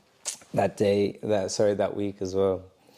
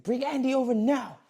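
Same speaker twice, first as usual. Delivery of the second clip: much higher, put-on trainer voice calling out; bossy trainer; 14 semitones higher than usual. Retelling the trainer ordering Andy over, high mock voice.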